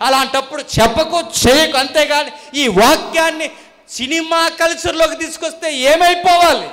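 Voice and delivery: loud, high-pitched pulpit tirade; indignant preaching. Real, not acted. Preacher denouncing mimicry in God's church, indignant forceful preaching. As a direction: denounce it with punched, rising phrases.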